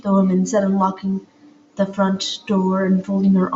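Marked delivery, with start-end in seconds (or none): none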